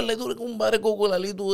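usual speaking voice

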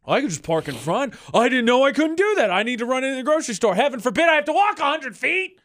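climbing, high whine of an entitled driver; mock indignation. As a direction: build from casual to hammed-up outrage. Mocking an entitled driver's excuse.

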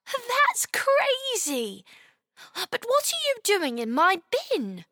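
high, drawn-out amazement; marvel, then ask, pitched high; high-voiced puzzled child; amazed little girl. A narrator's reading.

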